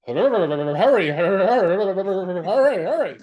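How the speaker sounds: mock engine cranking; imitating a sputtering truck. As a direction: sputter like a cold old truck. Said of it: "old Ford truck in the winter".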